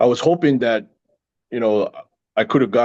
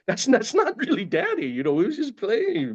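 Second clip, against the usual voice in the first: laughing reassurance; 13 semitones higher, 3 dB quieter than usual. Retold or imitated. Reassuring crying daughter, laughing.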